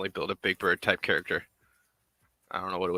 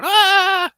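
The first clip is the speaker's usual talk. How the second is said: shrill squawking bird cry, very high and loud; piercing bird squawk; mockingbird screech; 14 dB louder than usual. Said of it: "mockingbirds going".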